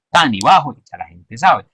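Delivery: ordinary talk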